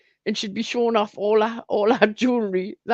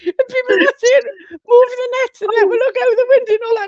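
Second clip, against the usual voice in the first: still laughing; 10 semitones higher, 5 dB louder than usual. Real, not acted. Still laughing through the story.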